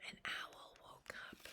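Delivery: very soft storytelling whisper. A narrator's reading. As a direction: whisper it into the sleeping hush. Owl wakes among sleepers.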